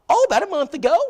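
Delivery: high falsetto imitation of an excited lady; mock high woman's voice. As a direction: squeak it out like a delighted Southern lady. Brenda answering at the door.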